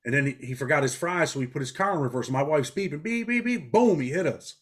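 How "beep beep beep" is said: horn imitation. Imitating wife's car horn.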